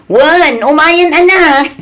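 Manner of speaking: called out high, loud and slow; calling out. Acted. Grandparent calling out to grandchild about dinner, loud and drawn out. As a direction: answer the call to dinner across the house.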